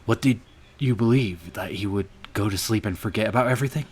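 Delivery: loud, rushed rebuke; angry scolding. Acted. Berating the leopard over his naive belief, loud and fast. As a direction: hammer the question at him.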